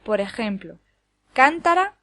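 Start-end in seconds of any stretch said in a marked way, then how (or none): 1.3-2.0 cántara punched high and loud, CÁN-ta-ra; stress on first syllable. Shown as a demonstration. Teacher contrasts words by accent, cántara stressed on CÁN. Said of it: "cambiar el acento de lugar".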